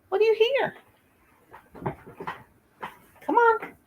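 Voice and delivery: high sing-song voice for a scared dog; pet talk. Real, not acted.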